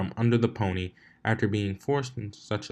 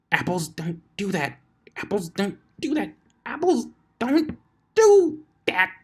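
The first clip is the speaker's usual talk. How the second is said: building frustrated rant; 11 semitones higher than usual. Acted. Twilight ranting at the apple, from mutter to cry. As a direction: repeat it, cracking more each time. Twilight repeating, losing her composure.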